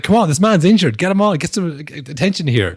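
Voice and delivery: mock urgent referee; hammed-up urgent call. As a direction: call the physio on in mock alarm. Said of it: "He's like".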